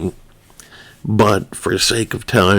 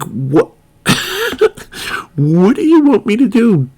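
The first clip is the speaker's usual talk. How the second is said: flabbergasted; 14 semitones higher than usual. Retold or imitated. The friend reacts to the handcuffed Italian's call for help, much higher and flabbergasted. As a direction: throw up your hands in disbelief. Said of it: "flabbergasted, verklempt".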